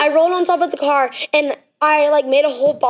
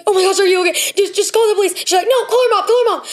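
imitating panic; 6 semitones higher, faster than usual.

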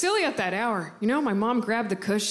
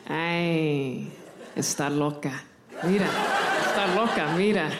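put-on lower, slow old abuela cooing in Spanish; great-grandmother admiring her granddaughter, lower and fond; great grandma's voice; 5 semitones lower, slower than usual. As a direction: croon like a doting old grandmother. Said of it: "my great grandma's voice".

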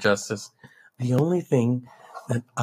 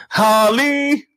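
high loud shout; Joker calling; 12 semitones higher, 7 dB louder than usual. Acted. Joker shouting for Harley, high and loud. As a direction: call out for Harley.

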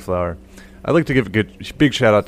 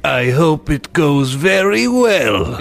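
slow, eerie and higher; spooky voice; 6 semitones higher, much slower than usual. Retold or imitated. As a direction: intone the wedding wish like a ghost. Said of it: "it's just always spooky".

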